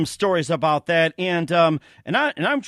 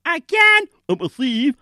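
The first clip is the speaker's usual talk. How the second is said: squeaky, much higher cartoon squirrel voice; cartoon squirrel impression; 9 semitones higher, 4 dB louder, slower than usual. Host answering as the cartoon squirrel, squeaky and high. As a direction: chirp it like a tiny flying squirrel. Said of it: "I hope I did a good one right there".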